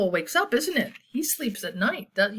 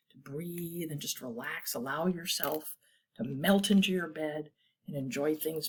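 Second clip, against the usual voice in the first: soft, low, calming voice; soothing; 4 semitones lower, 6 dB quieter than usual. Acted. Reader helping children settle down to sleep, soothing. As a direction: ease them down gently, very quietly.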